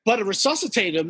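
normal talking voice

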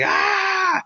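high-pitched held mock scream; long, high war-face cry; imitated crowd yelling. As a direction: mimic a whole audience screaming "aah". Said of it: "they all start yelling".

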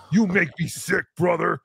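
mock wrestler promo voice; Hulk Hogan growl. Host reading a wrestling super chat, in a mock Hogan growl. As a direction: ham up a wrestling heel's taunt.

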